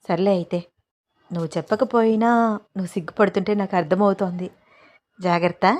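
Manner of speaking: plain reading voice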